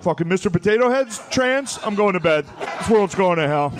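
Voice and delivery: dad fed up with the news, mock fury; mock outraged dad. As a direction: storm off to bed in disgust. Angry Fox News dad outburst.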